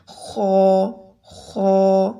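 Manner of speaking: خو said thick and full, not a plain خا; heavy kho. Shown as a demonstration. Teacher contrasting heavy خو with plain خا. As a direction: make خو heavy and rounded. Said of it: "اس کو خا نہیں پڑھنا".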